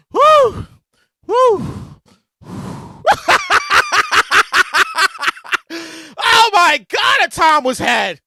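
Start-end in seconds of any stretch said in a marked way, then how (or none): 0.1-1.7 loud high whoops; whooping. Real, not acted. 2.5-6.2 hearty laugh. Real, not acted.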